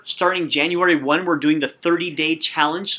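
normal talking voice

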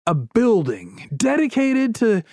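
slow grand pitch dropping on the big name; hammed-up dramatic unveiling; showman reveal. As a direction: unveil it like a carnival barker. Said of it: "He's like".

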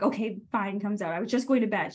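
usual speaking voice

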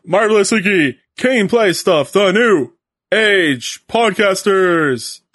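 booming ring announcer intro; ringmaster. Pretend.

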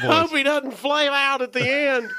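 high, loud race caller; horse race announcer. Pretend. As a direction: keep up the track announcer call. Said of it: "Why is that your announcer voice?".

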